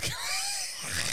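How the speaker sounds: high breathless wheeze of a laugh; silent laugh. Real, not acted. Helpless laughter.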